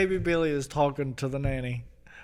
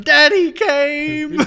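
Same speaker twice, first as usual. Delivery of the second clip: high, loud, slow scream; 13 semitones higher, 10 dB louder, much slower than usual. Retold or imitated. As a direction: yell it out like horrified kids. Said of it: "They start screaming".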